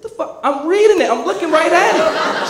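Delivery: high-pitched protest rising to loud; acted indignant outburst, stretched at the end; exasperated disbelief. Father reading the post to denying daughter, high exasperated voice.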